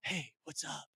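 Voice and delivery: hushed, very soft whisper; mock whisper. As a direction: imitate someone whispering a greeting. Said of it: "Sounds like someone's whispering".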